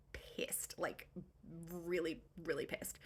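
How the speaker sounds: quiet, low-pitched understatement with weighty pauses; hushed emphasis. Real, not acted. Narrator stresses the families' fury, hushed emphasis. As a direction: drop to a near-whisper, low and very soft.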